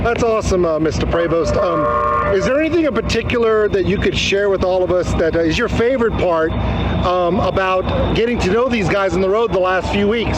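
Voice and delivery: high, bright-eyed interviewer bit; hammy reporter voice. Pretend. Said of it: "I gave the bus a Texas accent".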